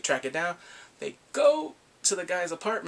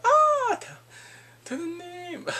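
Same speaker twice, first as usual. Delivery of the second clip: sudden, much higher and louder shriek; mock startled shriek; 5 dB louder, slower than usual. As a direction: shriek as if caught red-handed. She's caught on TV.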